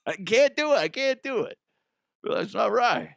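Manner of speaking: high-pitched, protesting put-on voice; bad impression. Host does a terrible impression of someone protesting, high hammed-up voice. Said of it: "will not be asked to do that impression".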